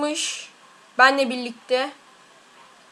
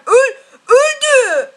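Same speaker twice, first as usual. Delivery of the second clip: laughing; 9 semitones higher, 5 dB louder, slower than usual. Real, not acted. Enemy dies, laughing.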